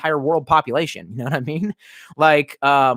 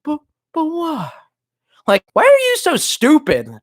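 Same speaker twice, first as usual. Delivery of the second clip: much higher, slow then louder mocking whine; mock confusion; 14 semitones higher, 4 dB louder than usual. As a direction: parody clueless people, then snap at them. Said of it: "And they're like".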